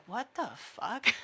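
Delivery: slow, quiet, baffled laughing line; baffled locals. Retold or imitated. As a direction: breathe it out in confused, amused disbelief. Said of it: "the locals are like".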